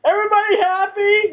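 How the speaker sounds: high-pitched imitation of a yelled question; mock yell. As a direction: call it out to a whole room, half-hearted. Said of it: "yells at everybody who's eating at his restaurant".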